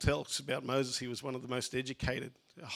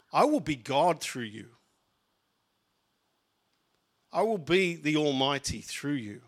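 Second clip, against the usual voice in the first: louder, rising sermon delivery with long pauses; fervent preacher voicing God's promises; full-force preaching; 4 dB louder than usual. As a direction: preach it, building with every "I will".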